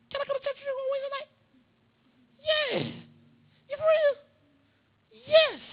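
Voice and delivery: put-on squeaky and eager, like a kid asking; kid brother voice. Little brother asks to go to church, high and eager.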